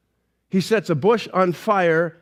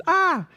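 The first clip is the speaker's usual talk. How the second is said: mock scream; 9 semitones higher than usual. Moses sees his staff become a snake, mock scream. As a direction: give a quick pretend scream, pitched way up. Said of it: "And Moses screams".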